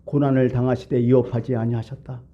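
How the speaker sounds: ordinary delivery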